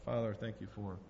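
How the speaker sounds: usual speaking voice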